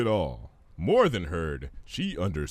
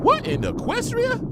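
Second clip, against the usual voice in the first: very high astonished cry; startled Pinkie; 4 dB louder than usual. Acted. Pinkie startled by the thunder, very high. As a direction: gasp it out in surprise.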